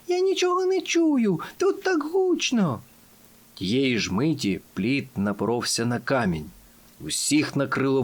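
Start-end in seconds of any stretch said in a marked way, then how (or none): 0.1-2.8 very high, stretched-out yelling; bawling. A narrator's reading. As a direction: yell high as if over a roar. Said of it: "загорлала Бріош".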